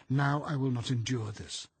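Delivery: high, loud, insistent outrage; frightened but furious determination; defiant inner rant. A narrator's reading. Inner rant working up to yell.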